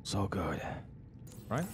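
breathy hushed whisper. Real, not acted. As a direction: whisper it in bliss. Savouring coffee.

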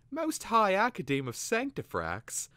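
high, loud, drawn-out amazement; blurted out. A narrator's reading. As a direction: marvel at the lofty title. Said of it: "Twig blurted out".

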